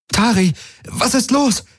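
very high, alarmed call; calling over noise. A narrator's reading. Kirai calling Tari over the noise, alarmed call. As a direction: call out high to cut through the din. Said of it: "rief sie über den Lärm".

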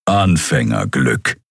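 low, slow growl of a cat warrior. Acted. Warrior belittles his small comrade with a slow growl. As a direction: drag it out as a dry growl. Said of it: "knurrte Keru".

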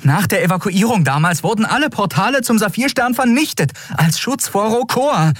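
hoch und erregt; aufgebrachter Widerspruch. Acted. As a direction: halte ungläubig dagegen. (erregt widersprochen)

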